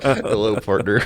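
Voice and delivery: lightly drawled Southern greeting; a hint of a Southern cowboy; Southern accent. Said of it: "whales having a southern accent".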